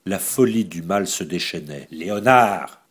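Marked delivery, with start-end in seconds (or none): 1.9-2.8 loud summons; calling out sharply. A narrator's reading. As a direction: call the henchman in. She calls her henchman. (appel sec et fort)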